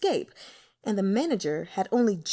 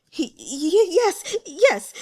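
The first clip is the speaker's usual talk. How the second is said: flustered, much higher stammered yes; panicked; 8 semitones higher, 7 dB louder, much slower than usual. A narrator's reading. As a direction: stumble over the answer in panic. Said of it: "galloped away in a panic".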